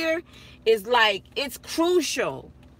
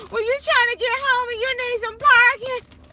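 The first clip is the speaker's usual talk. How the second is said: excited brag; 8 semitones higher, 4 dB louder than usual. Real, not acted. Boasting about parking skills.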